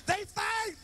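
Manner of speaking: high, loud yelled announcement; shouted announcement. Retold or imitated. Neighbors shouting out to announce the fight. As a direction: holler it out to the whole block.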